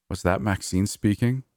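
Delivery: usual speaking voice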